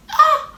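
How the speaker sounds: very high cry of annoyance; frustrated shriek. Retold or imitated. Pissed off after the phone message.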